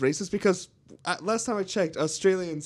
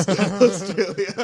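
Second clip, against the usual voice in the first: loud, higher, slowed by laughter; laughing; 6 semitones higher, 8 dB louder, much slower than usual. Real, not acted. Delivering the emu war punchline, laughing. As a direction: laugh through the word.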